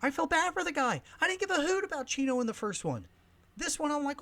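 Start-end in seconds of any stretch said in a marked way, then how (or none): none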